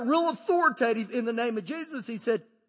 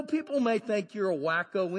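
ordinary talk

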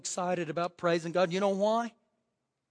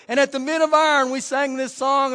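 usual speaking voice

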